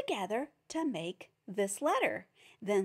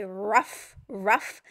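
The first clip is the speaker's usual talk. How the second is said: short barked ruff, louder on the second; playful dog bark for the class; 5 dB louder than usual. Shown as a demonstration. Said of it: "It sounds like a dog barking".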